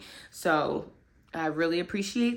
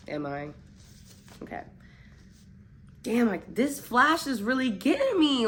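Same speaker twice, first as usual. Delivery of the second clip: ordinary delivery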